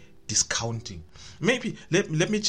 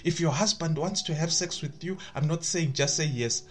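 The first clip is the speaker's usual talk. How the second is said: normal talking voice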